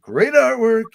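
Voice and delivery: high, loud "Great artwork"; excited. Shown as a demonstration. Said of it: "repeats the praise three ways".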